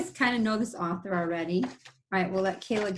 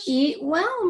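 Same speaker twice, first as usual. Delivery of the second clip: higher, sly wolf call; wolf crying out; 7 semitones higher, 4 dB louder than usual. Acted. Teacher voicing the wolf calling to the chicken. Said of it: "he cried".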